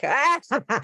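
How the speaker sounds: mock laugh breaking the posh act; cracked-open laugh. Shown as a demonstration. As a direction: burst out of the fancy voice into a laugh. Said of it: "then crack open a, like".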